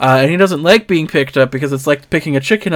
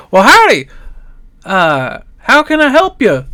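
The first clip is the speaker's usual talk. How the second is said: high, loud, bright-eyed howdy; a perky bird-like clerk hollering hello; cheery shopkeeper greeting; 9 semitones higher, 6 dB louder than usual. Acted. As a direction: greet the customers with a big country welcome. Shopkeeper NPC greets the party.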